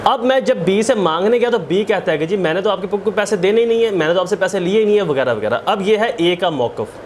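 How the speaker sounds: ordinary talk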